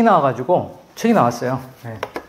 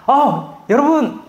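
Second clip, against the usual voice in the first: high, bright-cheered coach voice, louder; cheery running coach; 8 semitones higher, 4 dB louder than usual. Retold or imitated. Running app coach cheering runners, mock cheerful and high. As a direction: mimic an upbeat app coach cheering you on. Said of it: "이런 음성이 들리는 거예요".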